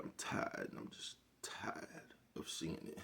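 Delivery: slow, worn-out whisper; tired whisper. Real, not acted. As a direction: let it drop to a breathless whisper of fatigue. Weary confession of being tired.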